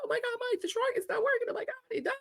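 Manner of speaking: high, breathless panic retold; panicked call. Wife calling husband Mike after the truck died, high panic. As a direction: squeal it out like the truck just died.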